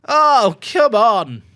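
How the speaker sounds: high, loud oh come on; celebrating shout. Real, not acted. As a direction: cheer the goal out loud. Goal celebration.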